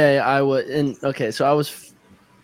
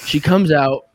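ordinary talk

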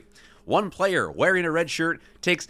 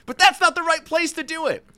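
high-pitched, heated protest; acted exasperated protest; 11 semitones higher, 4 dB louder than usual. A narrator's reading. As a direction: argue back in exasperation. Acted retort, older man.

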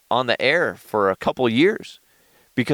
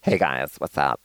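mock normal voice; 6 semitones lower than usual. Shown as a demonstration. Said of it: "That was just my normal voice".

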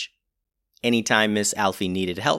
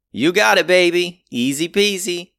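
breezy engineer; 8 semitones higher, 6 dB louder than usual. Acted. Lala's breezy reply.

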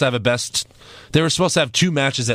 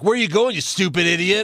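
high, mocking taunt; imitating Jericho; 5 semitones higher than usual. Host jokes Jericho insults him as driver, mock taunt. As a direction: sneer at the driver like a heel. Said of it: "Jericho would be like".

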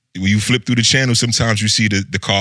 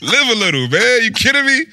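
teasing; 11 semitones higher than usual. Real, not acted. Teasing friend to loosen up.

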